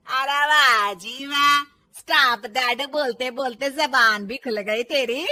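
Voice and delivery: slow, loud, mocking outrage; angry sarcasm. Mother-in-law mocking the daughter-in-law's new boldness, angrily sarcastic. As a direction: draw out the scornful praise.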